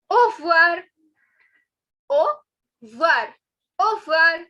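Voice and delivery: au revoir as oh-vwar, loudly; simplified pronunciation. Shown as a demonstration. Teacher drilling au revoir for learners, loud and simplified. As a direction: say "au revoir" without the re. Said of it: "we will just pronounce it as".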